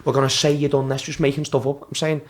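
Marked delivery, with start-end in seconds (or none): 0.0-1.0 higher, lightly voiced mock manager. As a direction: take him off with a sneer. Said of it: "He was saying".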